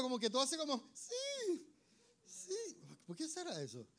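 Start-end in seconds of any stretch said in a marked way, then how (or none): 0.9-2.8 tiny high squeak; soft, very high mock-scared yes; voice of fear. Showing how fear changes you, high timid squeak. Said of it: "ve la voz del miedo".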